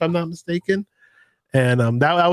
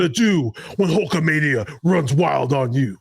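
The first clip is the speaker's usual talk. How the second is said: hammed-up wrestling promo voice; swaggering strongman wrestler impression; Hulk Hogan. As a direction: challenge a rival in a big promo voice. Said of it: "Talk like Hulk Hogan?".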